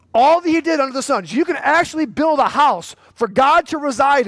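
rally the congregation; fiery preaching. Acted. Pastor urging church members to share the gospel, fired-up preaching.

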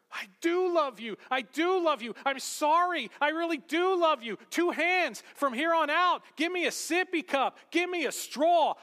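high, loud hammed-up kid outburst; mock tantrum of apology, shouting by the end; frantic pleading child. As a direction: beg desperately like an upset little kid. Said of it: "And he starts crying".

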